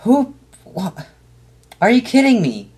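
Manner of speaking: high, stunned disbelief; can't believe what you're hearing; incredulous. Real, not acted. Reacts to demonic kids app.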